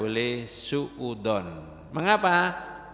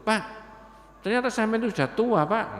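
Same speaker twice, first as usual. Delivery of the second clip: put-on quick, higher, cheeky young man's blunt remark; blunt Surabaya youth; 8 semitones higher, 3 dB louder, faster than usual. Young man bluntly tells an old man he is old, fast and cheeky. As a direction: fire it off fast and careless, like a tactless youngster. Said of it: "Ini anak muda ini ngomongnya. Surabayaan".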